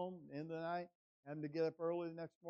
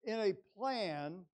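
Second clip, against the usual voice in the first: loud, weighty pulpit declarations; emphatic preaching; 4 semitones higher, 8 dB louder than usual. Real, not acted. Preacher telling congregation God designed and limits adversity, loud and emphatic. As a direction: hammer each point, stretching key words.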